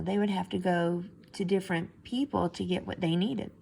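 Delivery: ordinary delivery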